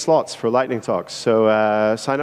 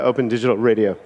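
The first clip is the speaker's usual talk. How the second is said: normal talking voice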